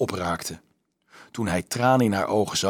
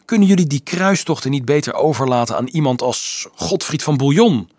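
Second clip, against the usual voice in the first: louder and higher, hard and bitter; grim; 5 semitones higher, 6 dB louder than usual. A narrator's reading. Dolf grimly asks Marieke to leave crusades to knights. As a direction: ask it grimly, fed up. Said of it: "vroeg hij grimmig".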